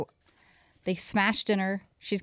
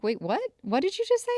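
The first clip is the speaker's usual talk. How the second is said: rising higher in stunned alarm; shocked disbelief; 8 semitones higher than usual. Retold or imitated. Mom shocked by daughter's cuss at dinner. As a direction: react as if you misheard a swear.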